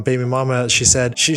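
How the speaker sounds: ordinary talk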